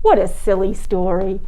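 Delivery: plain reading voice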